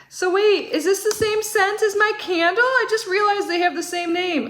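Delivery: sudden excited realization; surprised realization. Real, not acted. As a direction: catch it mid-thought, delighted surprise. Realizing the scents match.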